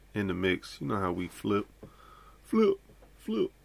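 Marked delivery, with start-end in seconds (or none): 2.4-3.5 high squeak. Acted. Hype echo of catchphrase.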